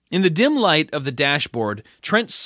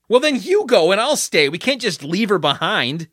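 urgent protest; 7 semitones higher, 5 dB louder than usual. Acted. Sean protests leaving her.

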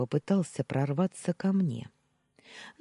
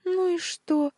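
snorting, scornful, pitched up high; scoffing; 4 dB louder, slower than usual. A narrator's reading. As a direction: brush it off with a snort. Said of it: "фыркнула она". (фыркая, с пренебрежением)

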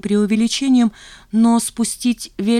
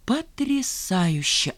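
slow, softer stunned exclamation; aghast gasp; 4 semitones higher, 5 dB quieter, much slower than usual. A narrator's reading. Zhanna hearing of the unpaid bill, gasping in disbelief. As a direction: draw it out, aghast. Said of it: "ахнула Жанна".

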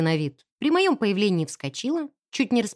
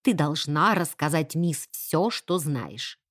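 low, slow stern insistence; frowning; 6 semitones lower, slower than usual. A narrator's reading. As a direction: press her sternly, dropping lower. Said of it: "нахмурилась Сиси". (строго, низким голосом)

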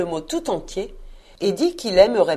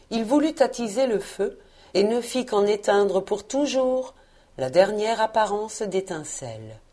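ordinary delivery

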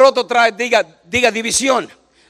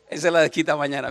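laughing; 7 semitones lower, 5 dB quieter, faster than usual. Real, not acted. Laughs at own joke.

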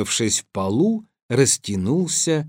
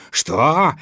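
raised, affronted question; angry cry; 6 semitones higher than usual. A narrator's reading. Tsar refuses to bargain with Ivan, angry cry. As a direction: rise in outrage on the challenge. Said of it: "закричал он".

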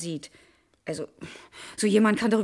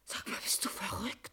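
breathless whisper, much softer; shaken whisper; 3 semitones lower, 15 dB quieter than usual. Acted. Wife whispering in shock after being attacked.

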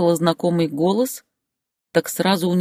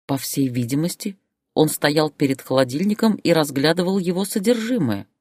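ordinary delivery

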